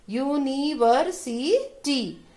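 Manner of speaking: "University" slow, in five separate beats; teacher's chunked model of "university"; syllable-by-syllable demo. Shown as a demonstration. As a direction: stretch each syllable of "university", falling on टी. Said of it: "अलग-अलग कर दिया".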